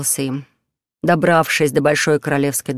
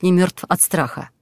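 ordinary delivery

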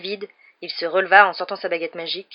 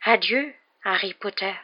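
slow, lilting elf goodbye; drawn-out, wistful little elf; emotional elf farewell; 3 semitones higher than usual. A narrator's reading. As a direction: say farewell haltingly in a small creature voice. Tearful elf bids farewell.

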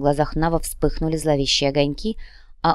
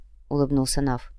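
ordinary delivery, nothing marked

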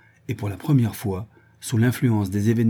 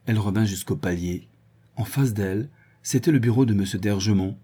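ordinary delivery, nothing marked

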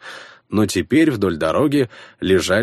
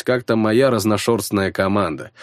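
ordinary delivery, nothing marked

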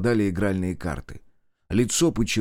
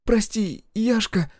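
high, wailing apology; wailing plea; 11 semitones higher, slower than usual. A narrator's reading. Kira begs Yasha's forgiveness, wailing. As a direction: plead in a much higher voice. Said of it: "Кира вдруг завыла".

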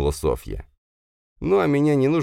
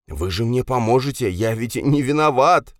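whining; 8 semitones higher than usual. Acted. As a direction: whine for help. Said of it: "законючил Кравчук". (Кравчук ноет и просит защиты)

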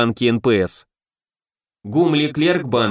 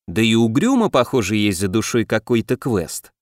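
usual speaking voice

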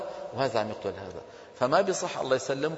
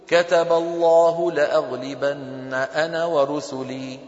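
measured recitation; 5 dB louder, much slower than usual. Shown as a demonstration. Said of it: "التجويد بيقول لي".

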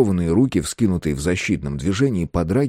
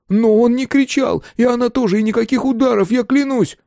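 high, loud, anxious insistence; frantic, pleading protest; hasty addition; 4 dB louder than usual. A narrator's reading. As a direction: rush to defend them, pitch right up. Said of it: "и поспешно добавил".

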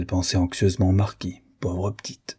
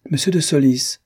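startled cry; 6 semitones higher than usual. A narrator's reading. Eva spots Monsieur de Solis approaching, startled cry. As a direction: call out the name, caught off guard. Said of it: "elle s'écria".

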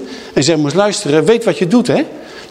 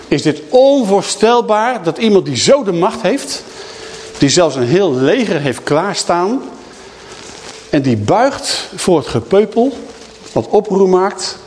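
slow indignation; 5 semitones higher, slower than usual. Real, not acted. Preacher indignant at Pilate.

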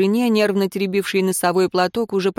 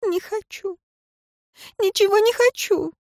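high broken whimper; sobbing; 14 semitones higher than usual. A narrator's reading. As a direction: whimper it through tears. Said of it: "тихо всхлипнула".

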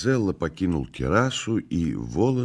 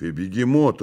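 plain reading voice

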